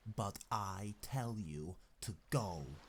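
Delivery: understated threat in character; controlled, menacing command. A narrator's reading. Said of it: "in a menacing voice".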